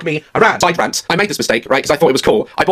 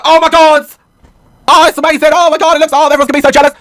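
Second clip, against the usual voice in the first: high, loud squeals of delight; excited daughter; 9 semitones higher, 5 dB louder than usual. Retold or imitated. Daughter seeing the finished volcano in the morning, thrilled and loud. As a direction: gush over the volcano like a thrilled kid. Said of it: "She's like".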